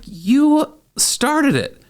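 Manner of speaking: high, slow, mock-indignant retort. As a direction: throw it back at her like a kid. Retorting in the date fight.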